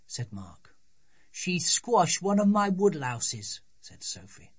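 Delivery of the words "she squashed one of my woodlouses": put-on slightly raised pitch, slow, aggrieved child; lightly voiced little girl. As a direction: report it like a wronged little girl. Child explains her misdeed to brothers.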